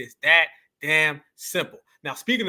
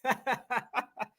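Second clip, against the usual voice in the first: laughing at the clip; 4 semitones higher, 8 dB quieter than usual. Real, not acted. Laughing at freeze frame.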